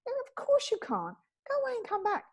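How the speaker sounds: high indignant brush-off; mock indignant. Celebrity sending the early driver off, high and fed up. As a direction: shoo him away, exasperated. Guest dismissing early cabbie.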